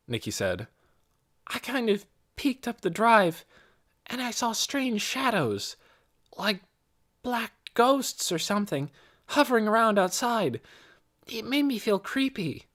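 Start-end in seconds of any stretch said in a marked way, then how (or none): 1.5-12.6 a hint of a girl's higher voice; lightly voiced young girl. A narrator's reading. Nicky answers Mrs. Beeson, lightly voiced as a girl. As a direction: lightly voice Nicky, eager and polite.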